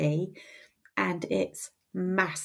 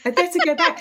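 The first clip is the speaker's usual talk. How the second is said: laughing; 8 semitones higher, 6 dB louder, faster than usual. Real, not acted. Laughing at own rambling.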